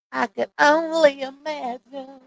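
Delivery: high, drawn-out mimicking voice; Footbeat. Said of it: "in my Footbeat voice".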